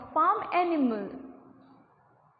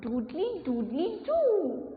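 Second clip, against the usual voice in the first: rooster crow. Shown as a demonstration. Teacher shows kids the rooster's morning crow. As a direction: crow like a cockerel at dawn. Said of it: "They sound".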